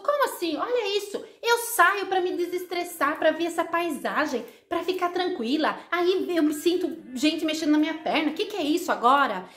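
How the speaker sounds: getting irritated. Acted. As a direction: complain like someone losing her patience. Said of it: "ela começou a ficar nervosa".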